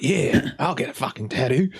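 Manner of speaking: put-on a rough, hammy Australian accent. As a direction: toss it off in a bad Australian accent. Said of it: "Sorry for the terrible Australian accent then".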